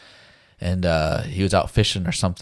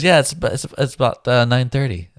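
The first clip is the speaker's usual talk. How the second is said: higher and louder, a hint of the wife; light wife voice; 6 semitones higher, 6 dB louder than usual. Retelling the wife giving the time, lightly higher. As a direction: mimic her checking the time.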